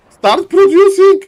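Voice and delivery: high, loud pleading yell; exasperated shout. Acted. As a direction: scream at him to spend the gold. Caster urging player again.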